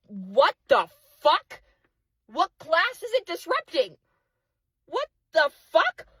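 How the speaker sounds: high, incredulous angry outburst; real exasperation, pitched high; so mad. Real, not acted. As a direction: let the voice shoot up in outraged questioning. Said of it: "I'm so mad".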